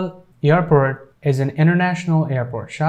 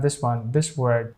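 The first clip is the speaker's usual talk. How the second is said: ordinary talk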